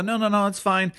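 high-pitched, insistent polite refusal; polite waving-off. Retold or imitated. Insisting to barista it's fine.

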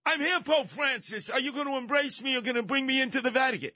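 high, fast mock plea; pleading migrant. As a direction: rush the plea up high at the gate.

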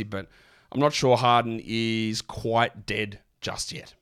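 usual speaking voice

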